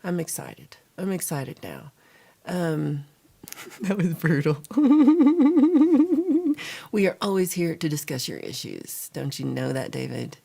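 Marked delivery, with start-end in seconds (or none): 4.7-6.9 big laugh. Real, not acted. Laughing at brutal comment.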